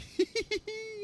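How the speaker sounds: tiny high-pitched mock giggle. Vikings in the sleeping bag, squeaky mock giggle. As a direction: giggle like a shy kid. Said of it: "were just like".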